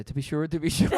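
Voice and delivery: loud, hammed-up Irish accent. Shown as a demonstration. Host missing Scottish, in a loud mock Irish accent. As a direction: throw out a stock Irish phrase. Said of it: "that was Irish".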